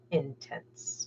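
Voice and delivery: soft flat one-word verdict; deadpan understatement. Retold or imitated. Wife voicing the Wolf Lord, dry and quiet. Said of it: "And he goes".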